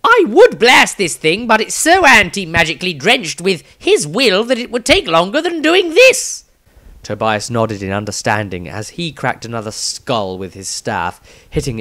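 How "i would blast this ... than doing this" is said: much higher, louder, exasperated shouting; a high-pitched panicky rant, outraged at his companion; squeaky outraged wizard voice; Maestro, indignant. Acted. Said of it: "Maestro spoke".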